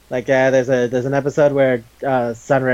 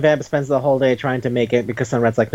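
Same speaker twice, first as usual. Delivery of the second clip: ordinary talk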